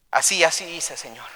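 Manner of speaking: soft admission, fading away at the end; subdued confession. Acted. Achan confessing what he has done, subdued. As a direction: let the last word drop almost to nothing.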